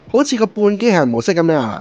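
ordinary talk

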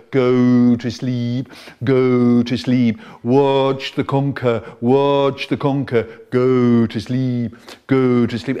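stage hypnotist swinging a conker. Acted. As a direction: lull them under with every word.

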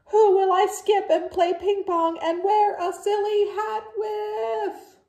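high, loud, whining lament; dramatic wail. Acted. Gerald laments to Piggy, high sing-song wail. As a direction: wail it like a heartbroken friend. Gerald wailing, sung at end.

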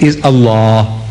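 low, deep-vowelled "Allah"; correct Arabic pronunciation. Retold or imitated. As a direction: say Allah with a dark, heavy L and open final vowel. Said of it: "I want you to pronounce".

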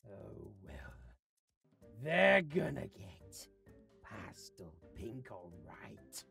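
quiet, low, slow menace; low grumbling. Acted. Decorator vowing they'll get pastel pink, low grumble. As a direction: draw it out softly to yourself. Grudging remark about customers' wishes.

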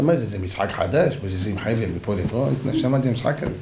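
low, baffled royal questioning; the king. Retold or imitated. King inspects the strange scene. (מלך תמה בקול נמוך)

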